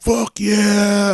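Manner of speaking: high, loud whooping cheer; hammed-up victory yell; wild celebration. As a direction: cheer like your side just won.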